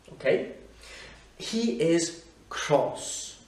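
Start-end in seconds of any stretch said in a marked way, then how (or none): 1.4-3.4 very slow, each word drawn out; slow teaching demo. Shown as a demonstration. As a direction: spell out the phrase slowly for learners. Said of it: "demo of the expression". (très lent, détaché)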